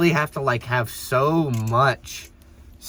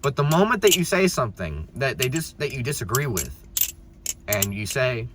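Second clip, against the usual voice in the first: ordinary delivery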